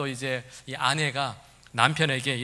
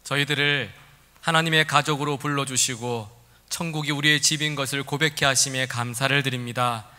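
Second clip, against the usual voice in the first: earnest, measured prayer voice; opening prayer; 2 semitones lower than usual. Real, not acted. Pastor praying before the sermon, earnest and steady. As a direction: pray aloud before the congregation.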